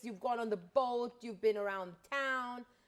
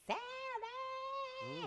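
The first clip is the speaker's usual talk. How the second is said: high-pitched drawn-out squeal; long, piercing fan shriek at moderate volume; imitated scream; 6 dB quieter than usual. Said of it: "she's literally screaming".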